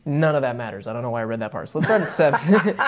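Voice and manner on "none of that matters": normal talking voice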